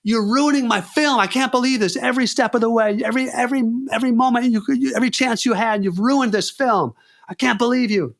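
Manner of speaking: high, heated tirade; enraged director blaming the actor; furious rant. Retold or imitated. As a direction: tear into him for ruining the film.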